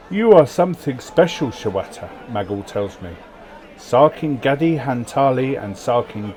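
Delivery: loud, grand storyteller reading; event narration. Acted. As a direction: read the event like a saga. Reads game event text aloud.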